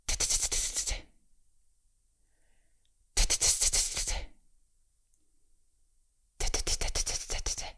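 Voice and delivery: T, t, t whispered fast and soft. Shown as a demonstration. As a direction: tap T, t, t quickly under the breath. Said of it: "Now a little faster".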